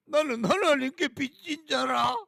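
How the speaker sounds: loud high mocking parrot of pastors; mocking quote. Preacher mocking pastors who boast of owing God. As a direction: shout it out as a sneering imitation. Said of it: "뭐라 그러니까".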